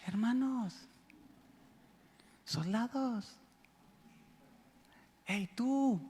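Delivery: hushed, hammed-up meek officer; mock gentle commander. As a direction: call the troops far too softly and meekly. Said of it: "No les dicen ... ¿Así dicen?".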